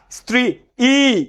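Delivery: স্ত্রী ই as "stri... ee", the ই on its own; separately pronounced vowel. Shown as a demonstration. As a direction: break off a second long ee after স্ত্রী. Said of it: "ই কি আলাদা উচ্চারণ করবা?".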